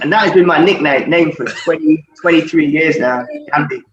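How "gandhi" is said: normal talking voice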